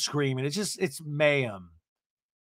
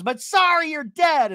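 high, loud, abrupt dismissal; hammed-up imitated yell; mock shouting; 13 semitones higher, 7 dB louder than usual. Tamara ending the eulogy, mock shout. Player dismissing friend, acted out.

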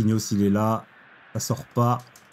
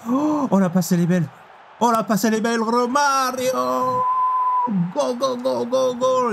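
excited cheering; 12 semitones higher than usual. Real, not acted. Gamer cheering Romario's pass toward goal, high excited cheering. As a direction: cheer the pass like a fan on his feet.

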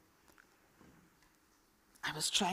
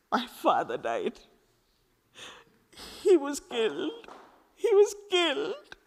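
breaking grief; 9 semitones higher than usual. Acted. Girl telling Khalid her father was killed, rising tearful grief. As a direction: voice rising higher and tearful as it goes.

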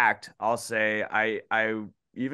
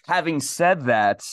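loud, higher; borrowing DJ's catchphrase; 5 semitones higher, 5 dB louder than usual. Retold or imitated. Co-host quotes DJ's signature line, loud and pointed. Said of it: "I'm gonna take a line from D J".

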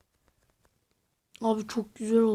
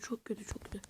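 whispered, softer, breathless worry; tense whisper; 2 semitones lower, 20 dB quieter, faster than usual. Real, not acted. Gamer whispering to himself while hiding, tense whisper. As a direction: whisper it so nobody hears.

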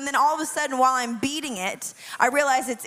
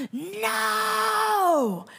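retold scream; 10 semitones higher than usual. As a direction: scream it as the kitten dives into the sea. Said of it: "I start screaming".

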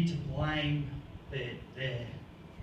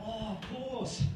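higher, softer dawning recognition; mock realisation; 5 semitones higher, 5 dB quieter than usual. Listeners suddenly placing the comedian's French accent, mock realisation. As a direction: act out the penny dropping.

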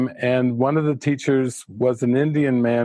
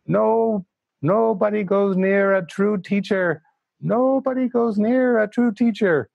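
high Indian English voice, teacher's gravity; an old Indian teacher's Indian English accent; 8 semitones higher than usual. Retold or imitated. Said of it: "in his Indian, Indian English accent".

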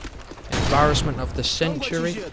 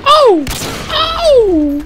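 two very high, loud "oh"s; piercing high-pitched cries; excited screaming; 12 dB louder than usual. Real, not acted. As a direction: shriek at a big moment in the game. Big moment in game.

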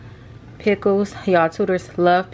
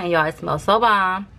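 ordinary talk, nothing marked

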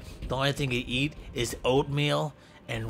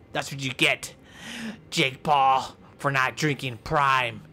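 ordinary delivery

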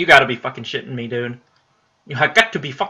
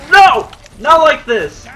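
screaming it high and loud in panic; desperate scream; 14 semitones higher, 10 dB louder, slower than usual. Real, not acted. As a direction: yell in real despair as the fight slips away. Losing a boss fight, screaming.

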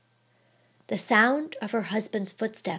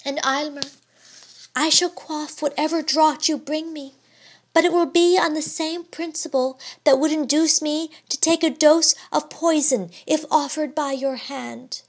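higher, gently resigned; devoted wife; 6 semitones higher than usual. A narrator's reading. Wife's resigned devoted vow.

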